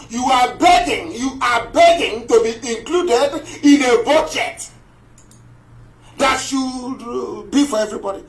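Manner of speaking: high, forceful scolding; indignant tirade. Real, not acted. Speaker berates Biafrans begging for a budget share, indignant. Berating those who beg for inclusion.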